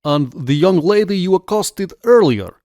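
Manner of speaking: put-on high, loud Russian-accented question. A narrator's reading. Officer questions a stranger, in a Russian accent.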